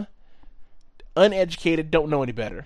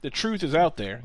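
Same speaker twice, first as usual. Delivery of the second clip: normal talking voice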